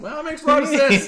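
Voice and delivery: high, laughing impression; lightly hammed nod of approval; mock grudging agreement. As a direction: play the sudden convert.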